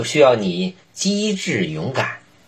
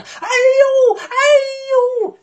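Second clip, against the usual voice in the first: very high, loud yelp of pain; a wolf with his tail caught; 4 dB louder than usual. Acted. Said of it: "痛得".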